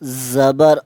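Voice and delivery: each letter drawn out and even; slow qaida spelling. Shown as a demonstration. Teacher spells slowly, pausing for students to repeat. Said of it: "मैं जब एक हर्फ को पढ़ लूँगा".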